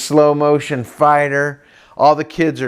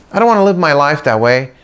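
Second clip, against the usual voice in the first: ordinary talk, nothing marked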